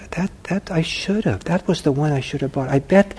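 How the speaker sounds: ordinary talk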